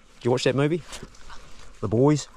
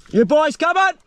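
long high shout to mates far off; calling out; 13 semitones higher, 6 dB louder than usual. Real, not acted. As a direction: call loud across the gully. Calling to distant hunting mates.

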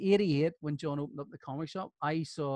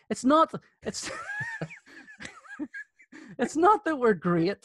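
laughing; 6 dB louder than usual. Real, not acted. Laughing at own joke punchline.

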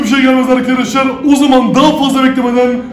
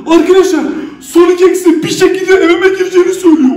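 usual speaking voice

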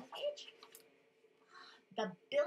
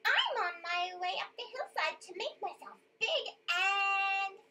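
high, louder tiny goat voice; very small voice; 9 semitones higher, 5 dB louder than usual. A narrator's reading. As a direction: squeak it out as the littlest goat. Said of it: "very small little voice".

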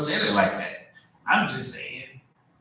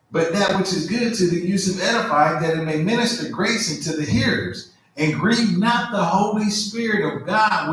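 raised, measured preaching read; scripture reading; 8 semitones higher than usual. Acted. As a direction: proclaim the verses to the congregation. Reads scripture aloud.

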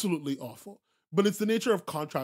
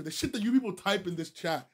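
ordinary talk

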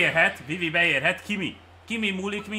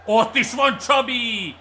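loud, high, slow drawn-out cry; excited call; 7 semitones higher, 6 dB louder, slower than usual. Real, not acted. Gamer hailing Csabi's play, drawn-out excited shout.

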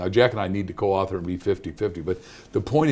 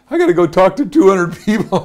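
panicked thought; 8 semitones higher, 8 dB louder than usual. Retold or imitated. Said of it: "I thought".

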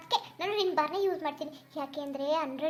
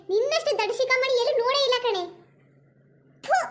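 put-on higher, louder nagging old mother-in-law; scolding mother-in-law; 4 semitones higher, 6 dB louder than usual. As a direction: berate the silly daughter-in-law. (ಬೈಯುವ ಅತ್ತೆಯ ಧ್ವನಿ)

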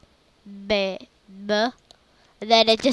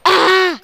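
sudden loud startled cry; loud scared yelp; 6 semitones higher, 9 dB louder than usual. Real, not acted. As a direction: yelp in fright. Startled by a jumpscare.

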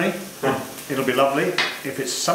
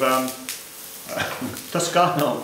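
ordinary delivery

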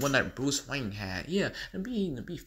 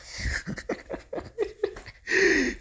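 high breathy giggle; evil giggle. Acted. Joker giggling at Batman. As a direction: giggle under your breath.

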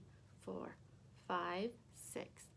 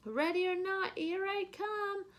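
loud, high sing-song call; 11 semitones higher, 5 dB louder than usual. A narrator's reading. Lulu calling out to the hiding monkeys, loud and high. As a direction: call it across the house to the hiders.

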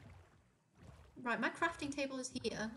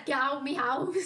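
acted quote; 2 semitones higher, 7 dB louder than usual. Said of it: "Richie said".

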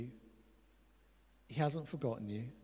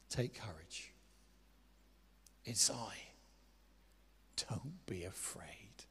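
low, very soft, nearly whispered; gentle reassurance; 7 semitones lower, 12 dB quieter, slower than usual. Acted. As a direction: comfort them slowly with long pauses. Voicing Jesus reassuring disciples.

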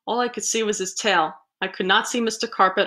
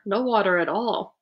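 ordinary delivery

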